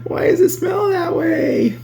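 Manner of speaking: put-on high squealing kid; kid disgusted. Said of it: "I was like".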